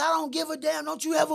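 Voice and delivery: high, angry mother's scold; mother yelling. Retold or imitated. Mother cursing at him after kicking the door, high and angry. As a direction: hit the pitch way up, furious.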